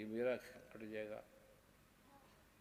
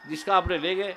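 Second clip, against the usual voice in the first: much louder, higher preaching cry; fiery preaching; 5 semitones higher, 17 dB louder than usual. Acted. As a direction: thunder at the crowd to let it out. Preaches at full force. (जोशीला प्रवचन, ज़ोर से)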